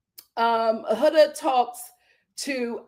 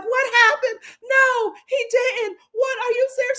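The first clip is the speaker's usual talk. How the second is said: high, shocked gasping questions; mock shocked girls; 11 semitones higher than usual. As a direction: gasp it out like worried friends.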